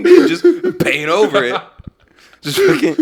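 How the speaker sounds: laughing through a repeated joke. Pretend. To friends, cracking up over the paint joke. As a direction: crack up while saying it.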